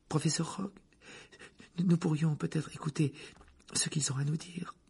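high, breath-filled timid plea; breathless. A narrator's reading. Student timidly pleads with the teacher, breathless. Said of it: "dit d'une voix haletante".

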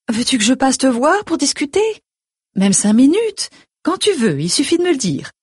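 high-pitched eager mother voice; solicitous mother. A narrator's reading. Mother voiced, offering to visit.

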